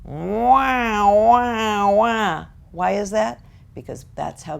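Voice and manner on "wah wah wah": loud, high drawn-out wah noises; cartoon parent wah-wah. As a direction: honk like an unseen cartoon adult. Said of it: "How are they portrayed?".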